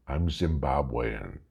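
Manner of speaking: ordinary talk, nothing marked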